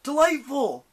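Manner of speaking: high, loud call; ringing, high-pitched shout; called-out taunt. A narrator's reading. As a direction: call it out to goad the monster. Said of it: "he called".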